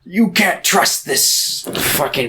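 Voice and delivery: high, slow put-on accuser voice; mock distrust. Sam warning Frodo about Gollum, mock indignant. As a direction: drag out a hammed-up warning.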